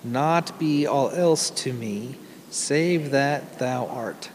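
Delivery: slow, measured verse with pauses between lines; solemn hymn recitation. Acted. Preacher reciting an Irish hymn to the congregation, slow and reverent. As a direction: read it slowly, line by line, as devotional poetry.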